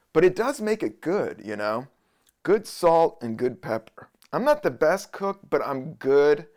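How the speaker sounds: ordinary talk, nothing marked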